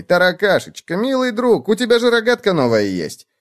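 high, sweet-talking little schemer; high-pitched cajoling storybook bug; wheedling bedbug. A narrator's reading. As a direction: coax a friend in a high sing-song. Clown-like bug character wheedles friend.

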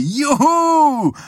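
a fan whooping at the rally. A narrator's reading. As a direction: yell it out from the crowd. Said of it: "jemand anders schrie".

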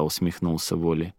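ordinary delivery

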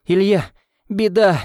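high, loud panicked cry; frightened shout. Acted. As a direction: shout the alarm to the boy. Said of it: "снова закричала няня". (испуганный громкий крик)

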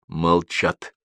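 ordinary delivery, nothing marked

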